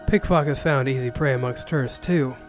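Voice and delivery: plain reading voice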